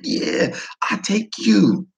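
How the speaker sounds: high, eager broken English; imitated Vietnamese English. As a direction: coax the guest to eat. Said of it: "He's like".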